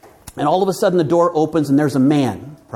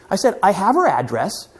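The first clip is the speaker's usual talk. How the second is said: ordinary delivery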